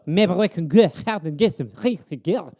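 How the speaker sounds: French-accented redo; French accent, hammed; mock French accent. Shown as a demonstration. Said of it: "Give me a little".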